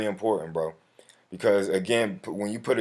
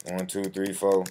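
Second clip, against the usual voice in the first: ordinary talk, nothing marked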